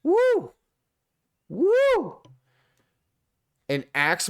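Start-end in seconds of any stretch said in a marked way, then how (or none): 0.0-2.2 high, loud hype whoops; excited cheering. Real, not acted. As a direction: whoop like the crowd went wild. Cheering the axe attack.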